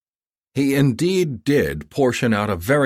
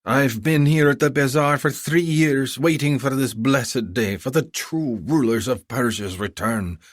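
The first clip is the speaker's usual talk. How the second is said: high, effusive haggling vendor; a flattering bazaar seller pitching; eager merchant; 6 semitones higher than usual. Acted. Vendor devoted.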